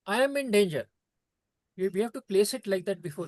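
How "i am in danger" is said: a lightly voiced ocean calling out for help, a bit louder; teacher plays the ocean crying out to the child; the ocean shouting. To the children, the ocean voiced as a mild mock shout. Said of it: "shouts to the child".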